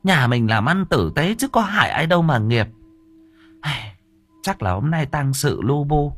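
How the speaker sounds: high-voiced old wife, puzzled then reassuring; dismissive wife. A narrator's reading. Wife dismisses husband's nightmare, high fussing reassurance. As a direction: brush off his fears in a high voice.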